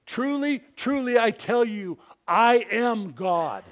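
high, loud proclamation, slowing on "I am God"; emphatic declaration. Acted. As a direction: declare it with full authority. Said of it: "what Jesus is saying there".

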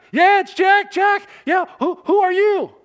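high, loud, urgent reply; excited shouting. Acted. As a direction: shout back to your unseen rescuer. Stranded climber answers a voice.

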